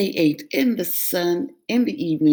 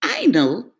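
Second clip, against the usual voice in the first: a touch smug; 7 semitones higher, 4 dB louder than usual. Acted. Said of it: "feeling very smart".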